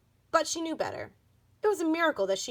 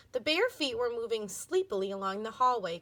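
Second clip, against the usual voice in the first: ordinary delivery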